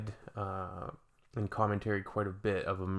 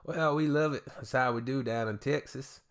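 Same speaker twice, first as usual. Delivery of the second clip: hammed-up Texan accent; put-on Southern US twang; 5 semitones higher than usual. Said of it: "they'll have the Southern accent".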